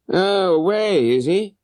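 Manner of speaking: a touch of feigned disappointment; disappointed. Acted. Holmes hears the boatman is away, mild disappointment. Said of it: "in a disappointed voice".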